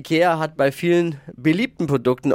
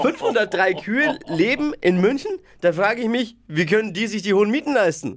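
mock astonishment; 8 semitones higher than usual.

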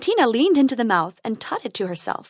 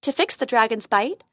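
usual speaking voice

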